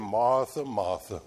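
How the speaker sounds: put-on low Southern-accented gentle name-call; lightly put-on Southern "Martha, Martha", falling low. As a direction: say it with a hint of a Southern drawl. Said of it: "if he had a southern accent".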